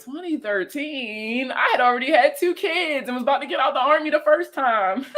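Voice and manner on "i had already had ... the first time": usual speaking voice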